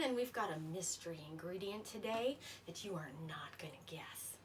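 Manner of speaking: hushed secret tease; whispering. Real, not acted. Teasing secret mystery ingredient.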